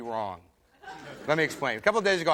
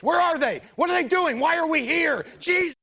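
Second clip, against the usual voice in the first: high, loud frantic questions; hammed-up battlefield panic, pitch way up; panicked Vietnam soldier; 12 semitones higher, 5 dB louder than usual. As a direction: shout in alarm at an unseen enemy. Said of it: "probably looks something like this".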